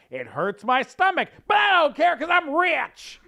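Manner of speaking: high, loud pompous boasting, building to a shout; rich braggart. Retold or imitated. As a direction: brag grandly, then explode on the last line.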